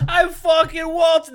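put-on high, loud, slow New York accent yell; New York shout. Cohost shouting in a mock New York accent. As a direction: holler like a New York guy. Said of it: "New York accent".

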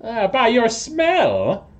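high, drawn-out toad-man voice; toad creature. Acted. Toad person tells visitors how he found them, high and slow. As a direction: answer slowly in a high creature voice.

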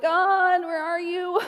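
high, plaintive call to God; pleading call. Retold or imitated. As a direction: call upward, pitched high, searching. Acts out pleading prayer.

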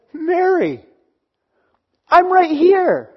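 Jesus lovingly reassuring weeping Mary, slow and lifted; tender Jesus. Pretend. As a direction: call her name gently, then insist you're here. Pastor voices Jesus comforting Mary.

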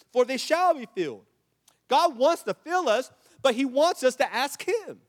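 high, loud, impassioned sermon; full-force preaching. Real, not acted. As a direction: drive it home from the pulpit, loudly.

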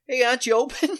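high, loud, breezy customer; casual customer voice. Retold or imitated. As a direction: act the oblivious regular casually. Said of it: "like, casual".

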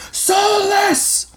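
high, far-off angry crowd yell; a militiaman shouting at Alphonse. A narrator's reading. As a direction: hurl the insult from the charging mob. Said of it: "clear shouts of angry voices".

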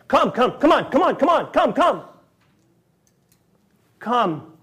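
high, loud, rapid urging come come; urgent evacuation call. Shown as a demonstration. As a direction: hurry them out like a flood warning. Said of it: "It is urgent".

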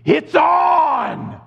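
thrilled holiday fanatic shouting; excited shout. Retold or imitated. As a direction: yell it like a starting gun. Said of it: "And you're like".

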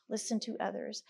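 soft echo. Shown as a demonstration. Speaker repeating proverb softly. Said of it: "the wise listen to others".

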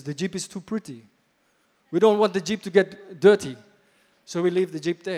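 put-on African accent, emphatic. Chief explaining the unused Jeep to pastor, African accent. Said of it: "That's my African accent".

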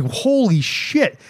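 high, slow drawn-out astonishment; mock shock. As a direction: stretch it out as the horror hits. Acted quote, shock at attack.

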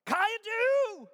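slow, high, loud shout; yelled. Acted. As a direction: yell the Greek like an alarm. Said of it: "willing to yell it".

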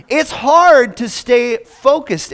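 slow, loud and high on hard; preacher's slow, loud emphasis; emphatic. Real, not acted. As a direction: lean hard into the word hard. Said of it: "preaching emphasis".

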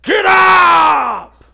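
high, drawn-out full-force yell; loud, imitated trainer's shout; going at them. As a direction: bellow it like the old coach. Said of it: "They need somebody to go at 'em".

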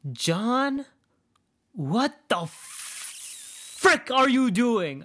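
high, very slow, drawn-out disbelief; exasperated high cry. Retold or imitated. A late rider to a stalled Uber driver, high and dragged out. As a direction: stretch it out like you can't believe him.

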